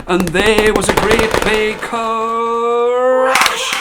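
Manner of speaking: booming storybook climax; dramatic crash. A narrator's reading. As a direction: land the crash big and slow.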